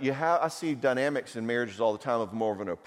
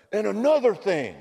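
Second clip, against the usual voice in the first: loud, higher, picking the fight back up; mock yell; 10 semitones higher, 6 dB louder than usual. Husband at bedtime restarting the fight, loud and higher. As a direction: barge in and bark it out.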